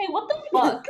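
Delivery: high, laughing mock protest; mock outrage. [name] to Bonnie over the torn pillow, mock outrage. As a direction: play affronted disbelief for laughs.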